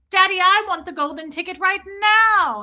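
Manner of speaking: high, loud spoiled demand; bratty child. Retold or imitated. Spoiled daughter demanding the golden ticket, bratty high whine. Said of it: "She's, she's like".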